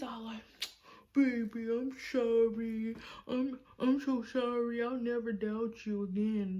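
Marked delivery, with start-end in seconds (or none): 1.1-6.6 mock pleading boyfriend. Boyfriend begging forgiveness, imitated.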